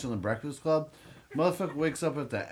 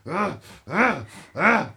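higher, louder enraged ah ah ah; furious sputtering grunts; 6 semitones higher, 7 dB louder, slower than usual. Retold or imitated. Retelling the brother's furious face after the fight, loud sputtering angry grunts. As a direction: mimic the brother choking on his rage. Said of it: "he was like".

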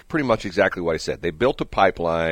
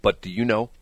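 ordinary talk, nothing marked

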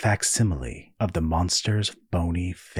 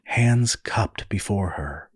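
usual speaking voice

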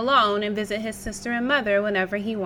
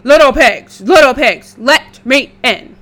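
wolf voice; 2 semitones higher, 14 dB louder than usual. Acted. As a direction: call out like a big bad wolf. Wolf third door.

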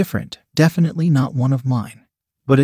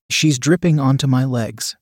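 usual speaking voice

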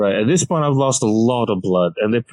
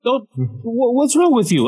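much higher, rising indignant cry; outraged yelp; 12 semitones higher than usual. Retold or imitated. Patient scolding the staring nurses, high mock-outraged yelp. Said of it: "I'm telling them".

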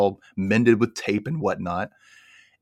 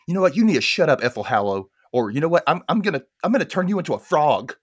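higher, heated threat to a bully; snapping back at a taunting rival; mock angry threat; 6 semitones higher, faster than usual. Mildred threatens Ethel, higher and heated.

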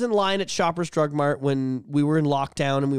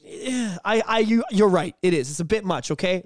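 usual speaking voice

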